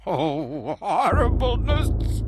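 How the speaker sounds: high shriek of disgust; anguished cry. Acted. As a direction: shriek in revulsion. Madman cries out.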